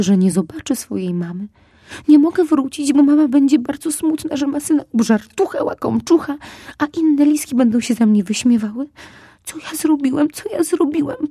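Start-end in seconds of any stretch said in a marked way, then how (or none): 2.1-11.3 despairing cry. Acted. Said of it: "Zaczął znowu płakać, tym razem z rozpaczy".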